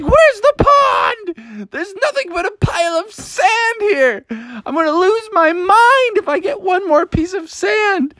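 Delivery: very high, hysterical wail; going insane. Acted. Froggy landing in sand instead of pond, hysterically wailing. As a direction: wail like you're losing your mind. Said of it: "he went insane more".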